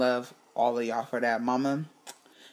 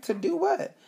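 surprised question; 13 semitones higher than usual. Retold or imitated. Sister's plan surprises her.

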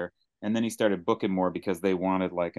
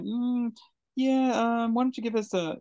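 hesitant request; 13 semitones higher than usual. Retold or imitated. Casting people asking an actor for an accent, high and hesitant. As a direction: imitate someone awkwardly asking, pitched well up.

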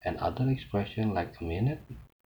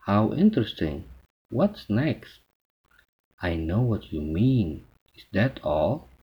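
louder, animated replies to a speaker; lively listener responses; 6 dB louder, much slower than usual. Shown as a demonstration. Teacher modelling attentive replies for students, lively. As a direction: show keen interest as you answer.

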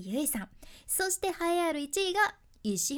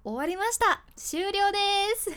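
mock despair; 8 semitones higher, 6 dB louder than usual. High-voiced woman mock mourning.

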